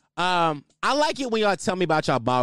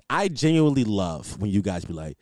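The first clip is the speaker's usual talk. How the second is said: normal talking voice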